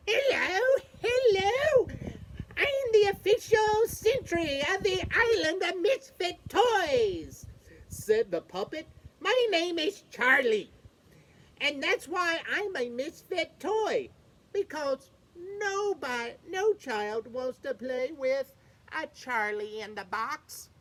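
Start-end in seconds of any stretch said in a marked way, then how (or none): 0.1-7.5 high, bright sprung-up puppet voice; much higher cartoon puppet greeting; jack-in-the-box puppet. 9.2-20.7 high, bright sprung-up puppet voice; much higher cartoon puppet greeting; jack-in-the-box puppet. Charlie-in-the-box explains himself.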